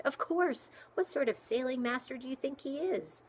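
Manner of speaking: high, loud outburst; exploding indignantly. A narrator's reading. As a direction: burst out, offended by the question. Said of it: "Nat exploded".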